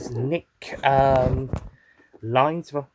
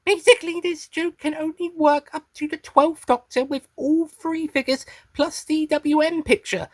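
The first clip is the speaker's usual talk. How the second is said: high-pitched creature explaining; Meep character voice; 9 semitones higher than usual. Acted. Still reading in the Meep voice.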